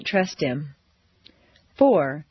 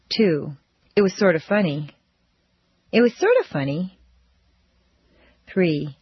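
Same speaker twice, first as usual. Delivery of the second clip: normal talking voice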